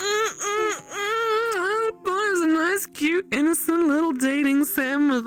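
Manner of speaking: high-pitched, laughing complaint; a high, giggly protest that fades down; laughing rant. Real, not acted. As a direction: whine it out through laughter.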